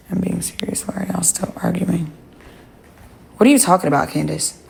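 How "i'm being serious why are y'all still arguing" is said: much softer, under the breath to chat; quiet aside. Real, not acted. To the chat about the arguing, a quiet aside. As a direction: drop the voice for a side remark.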